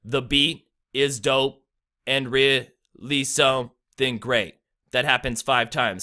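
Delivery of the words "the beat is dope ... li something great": slow unstressed-stressed pairs, landing on "beat", "dope", "great"; iambic pentameter. Shown as a demonstration. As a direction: break it into two-syllable beats, punching "beat", "dope" and "great". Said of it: "how many times that repeats in one".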